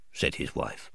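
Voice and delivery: plain reading voice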